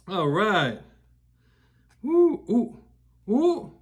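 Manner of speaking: ordinary delivery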